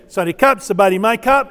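Sawasdee Krap with rising Krap endings, loud; male Thai greeting. Shown as a demonstration. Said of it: "So I would come to you and say".

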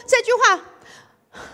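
high, loud complaint; pleading to Jesus. Retold or imitated. Retelling her prayer to Jesus, high pleading. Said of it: "我说：".

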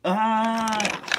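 high, loud cry of alarm; shouted scream. A narrator's reading. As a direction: yell out in fright as you flee. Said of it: "shouted Thomas".

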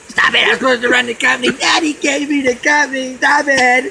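mock the spoiled boss who wants the company; whiny spoiled boss. Mocking boss Kyle's whining.

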